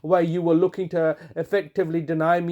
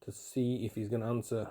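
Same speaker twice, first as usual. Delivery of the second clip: whispered aside; 7 semitones lower, 12 dB quieter than usual. Real, not acted. Whispered aside before the call.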